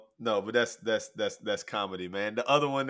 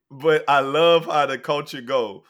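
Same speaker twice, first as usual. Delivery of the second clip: ordinary talk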